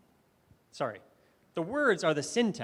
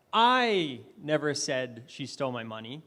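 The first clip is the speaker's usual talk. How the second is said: "I" drawn out long and loud, then the rest trails lower; stress on I; 3 semitones lower, 4 dB louder than usual. Shown as a demonstration. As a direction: lean hard and long on "I". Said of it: "changed was my inflection of the words".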